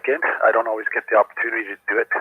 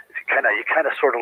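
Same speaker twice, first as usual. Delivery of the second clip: ordinary talk, nothing marked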